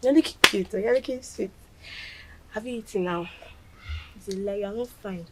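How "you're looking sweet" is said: ordinary talk, nothing marked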